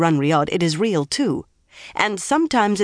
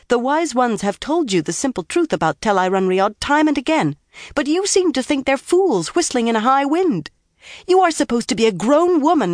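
higher-pitched, emphatic scolding; dressing down; 6 semitones higher than usual. A narrator's reading. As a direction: dress her down like a naughty girl. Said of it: "tried to dress her down".

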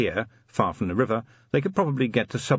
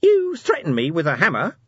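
high, loud, indignant little imp; accusing imp; 8 semitones higher, 5 dB louder than usual. Acted. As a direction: accuse him like a wronged gadget. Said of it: "said the imp accusingly".

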